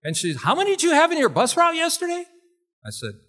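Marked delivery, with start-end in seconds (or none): 0.4-2.2 loud, very high, quick repeated demand; Marlene Evans. Retold or imitated. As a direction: imitate a loud teacher putting a student on the spot. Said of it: "she liked to talk loud".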